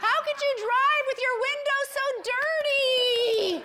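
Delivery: high, loud scolding outburst; mother's criticism. Retold or imitated. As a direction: blurt the criticism in a high mock-mother cry. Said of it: "because criticism comes out at the same time".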